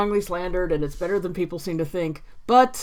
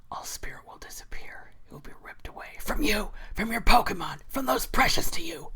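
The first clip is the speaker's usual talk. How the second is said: whispered villain threat that rises out of the hush; Cyrus voice; 5 dB quieter than usual. Acted. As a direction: start in an edgelord whisper, then press harder. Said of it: "hearing my Cyrus voice".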